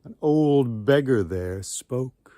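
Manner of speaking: plain reading voice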